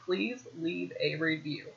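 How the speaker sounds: soft, slowed-down model sentence; slow prompting. Shown as a demonstration. Podcaster coaches a helper to ask for reviews, slow prompting. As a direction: say it slowly and softly for someone to copy. Models phrase for someone to repeat.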